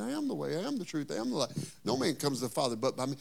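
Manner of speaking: Jesus answering Thomas, hushed; quiet telling. Acted. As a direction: drop it down and tell it gently. Jesus' answer retold, dropped soft.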